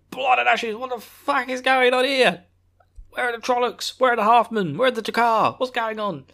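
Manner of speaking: high, loud alarmed soldier yelling; alarmed shouting. Retold or imitated. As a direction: bark out the alarm as you run in. Ingtar rushing in, alarmed.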